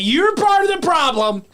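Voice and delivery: mock the scolding fan; whiny mocking voice. Mocking offended fans.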